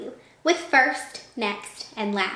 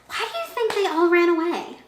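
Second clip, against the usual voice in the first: usual speaking voice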